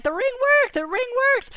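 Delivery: very high squeal of triumph; jubilant. Retold or imitated. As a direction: squeal it out, overjoyed. Joy that the ring works.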